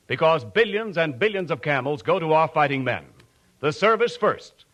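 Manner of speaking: ordinary talk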